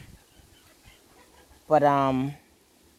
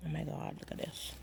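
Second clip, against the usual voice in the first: whispered wonder; hushed amazement; 17 dB quieter than usual. Real, not acted. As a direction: breathe it out in awe. Sees fruit on tree.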